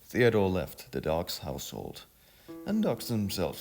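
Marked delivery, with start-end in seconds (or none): none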